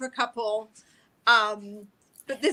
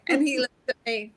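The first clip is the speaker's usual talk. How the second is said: ordinary talk, nothing marked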